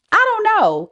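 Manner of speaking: squeaky high "I don't know"; playful high-pitched dodge; high mock shrug.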